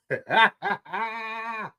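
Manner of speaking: chuckling laugh in a short run. Real, not acted. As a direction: laugh it out between phrases. Laughing at own word demo.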